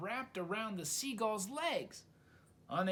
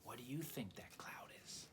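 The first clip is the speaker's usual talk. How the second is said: whisper; 5 semitones lower, 12 dB quieter than usual. A narrator's reading. Narrator whispers question to listeners.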